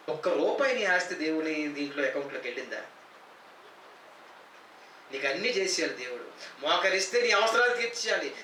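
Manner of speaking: ordinary talk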